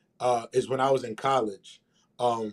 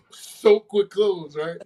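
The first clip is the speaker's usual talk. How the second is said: ordinary delivery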